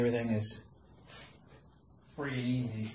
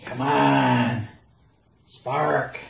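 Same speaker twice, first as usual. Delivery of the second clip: pleading come on, spark; will it to spark, loud; urging; 7 dB louder, much slower than usual. Real, not acted.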